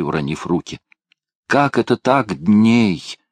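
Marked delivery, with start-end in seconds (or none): none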